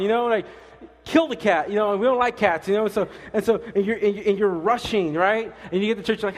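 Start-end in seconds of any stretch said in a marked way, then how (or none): 1.0-1.7 louder, higher joking yell; mock yell. Pastor imitating a stressed parent, joking mock yell. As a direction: throw it out in a rush. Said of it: "yelling at your wife to hurry up".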